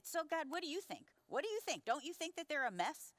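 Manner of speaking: high-pitched, exasperated questioning; retold ranting. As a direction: press for agreement, re-enacting your own complaint. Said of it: "as I had just ranted to God".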